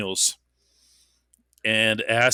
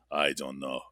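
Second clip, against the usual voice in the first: put-on Nigerian accent; 9 semitones lower, 8 dB quieter than usual. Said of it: "best Chooks Nigerian accent here".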